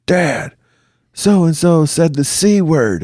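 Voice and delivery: put-on high-pitched kid calling out to Dad; mock yell, high and drawn out; imitated son yelling. Son tattling to dad from his video game, imitated yell. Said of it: "he yells to me".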